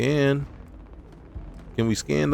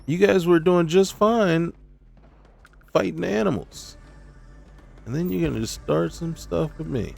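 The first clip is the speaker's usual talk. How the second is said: higher, indignant complaint at the attackers; exasperated outburst; 7 semitones higher than usual. Real, not acted. To the pirates who opened fire, higher indignant complaint. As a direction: whine at them for starting a fight. Enemies start shooting at him.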